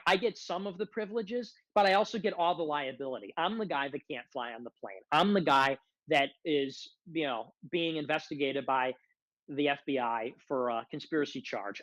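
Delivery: ordinary talk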